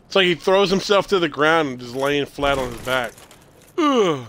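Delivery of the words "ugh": ordinary delivery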